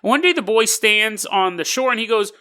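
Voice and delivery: usual speaking voice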